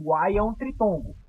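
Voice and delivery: ordinary talk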